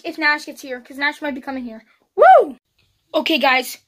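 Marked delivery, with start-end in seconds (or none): 2.2-2.6 loud high whoop; excited. Real, not acted. Vlogger cheers loudly into the camera to end a segment. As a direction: whoop it out big.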